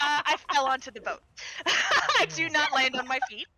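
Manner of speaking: real laughter breaking through the words; laughing. Real, not acted. Player telling the table of a botched landing, laughing. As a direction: tell it through helpless laughs.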